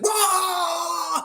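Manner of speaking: high, loud whooping yell; excited yell. Retold or imitated. Coach running into the locker room, loud excited whoa. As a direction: let out a big hyped whoa. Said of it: "yells into the room".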